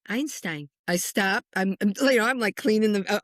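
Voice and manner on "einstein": matter-of-fact, softly falling single word; little boy's answer. Retold or imitated. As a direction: state it simply, like a small child answering. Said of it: "And he goes".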